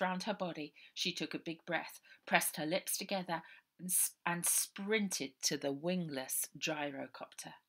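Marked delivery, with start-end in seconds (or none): none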